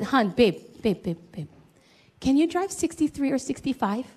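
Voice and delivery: soft, coaxing wife; imitated wifely request, softened; best wifey tone. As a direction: sweet-talk your husband, keeping it hushed. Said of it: "in my best wifey tone".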